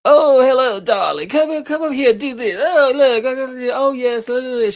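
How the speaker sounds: high, loud theatrical grande dame; Auntie Mame, harsh and grating. Retold or imitated. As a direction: swoop up high like a grating diva. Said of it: "she's so harsh and grating to me".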